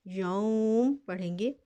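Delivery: यौम ending on a still मीम, slowly; pausal form. Shown as a demonstration. Teacher shows the pausal form, slow recitation. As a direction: stop on यौम with no vowel at the end. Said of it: "वक्फ की हालत में यौम".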